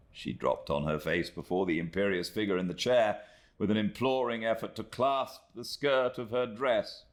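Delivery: plain reading voice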